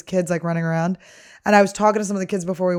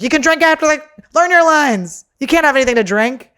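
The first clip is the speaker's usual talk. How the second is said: loud, high scolding parent; mock stage parent; 7 semitones higher, 8 dB louder than usual. Comedian imitating parents scolding kids, loud mock yell. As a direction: bark orders like a pushy stage mom.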